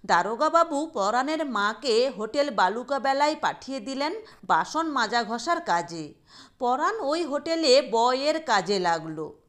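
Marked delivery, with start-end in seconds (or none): none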